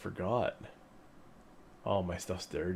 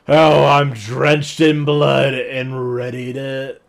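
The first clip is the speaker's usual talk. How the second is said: hammed-up heroic; 6 semitones higher, 15 dB louder, slower than usual. Dramatic game remark.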